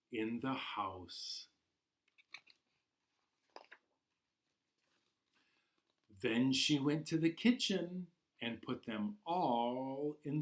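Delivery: gentle storybook narration, unhurried; reading to children. Acted. Teacher reading Ghosts in the House to class, storybook voice. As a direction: draw the little ones into the tale. Reads picture book to children.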